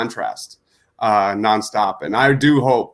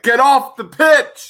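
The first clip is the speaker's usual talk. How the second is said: high, drawn-out mock yell; imitated yelling; 13 semitones higher, 6 dB louder, slower than usual. Nate to the boys from the background, mock yelling. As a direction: holler it slowly across a field. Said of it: "Nate in the background yelling at the boys".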